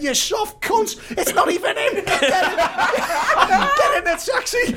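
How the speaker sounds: high-pitched telling-off through laughter; scolding mother. Retold or imitated. Mother ordering her son into the taxi, high and laughing. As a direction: scold like an exasperated mum, cracking up.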